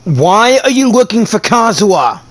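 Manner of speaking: ordinary talk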